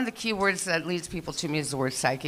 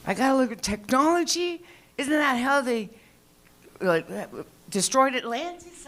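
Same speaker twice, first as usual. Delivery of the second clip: higher, disbelieving squeal at having to learn tech; incredulous; 7 semitones higher than usual. Retold or imitated. Comic aghast at learning technology, high incredulous disbelief. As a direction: make it sound absurd, pitched way up.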